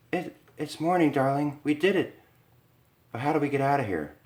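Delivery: breathless joy at surviving; relieved Lisa. Acted.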